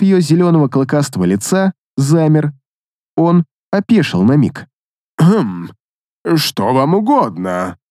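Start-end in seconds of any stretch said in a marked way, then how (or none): 5.2-7.8 slow, displeased drawl. A narrator's reading. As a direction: drag it out with disdain. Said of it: "Недовольно протянул".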